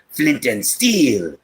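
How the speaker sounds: slow, drawn-out dramatic movie line. Shown as a demonstration. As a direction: announce it with hammy grandeur, stretched out.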